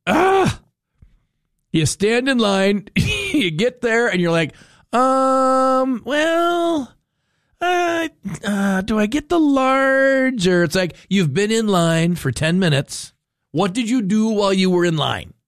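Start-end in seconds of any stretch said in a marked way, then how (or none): 4.9-10.5 high, hesitant, slow mock customer voice; dithering customer. Host mocking a fair customer who can't decide, high and dithering. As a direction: play the clueless customer stalling at the counter.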